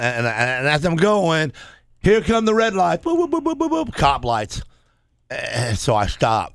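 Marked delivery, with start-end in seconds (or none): 2.0-4.6 high mock police siren boops; siren noise. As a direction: imitate the cop lights flashing. Siren imitation in story.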